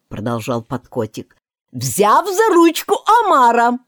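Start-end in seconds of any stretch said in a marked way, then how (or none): 1.7-3.8 higher, louder eager cry; shout. A narrator's reading. Gryphon shouts the dance steps to Alice. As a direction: call out the step excitedly. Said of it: "закричал Грифон".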